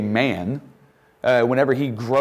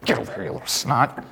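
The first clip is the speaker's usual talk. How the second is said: mild mock scolding. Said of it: "Joseph became angry and took hold".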